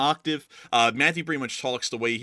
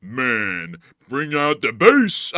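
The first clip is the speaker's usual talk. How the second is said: louder, drawn-out playful call; mock bass-booster; 3 semitones higher, 9 dB louder, much slower than usual. Co-host joking about blown speakers, mock booming call. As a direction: stretch it out big and loud.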